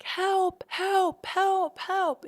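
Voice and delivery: high, loud panicked cries for help; screaming for help. Retold or imitated. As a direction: yell for help as if running for your life. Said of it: "I start screaming in Spanish".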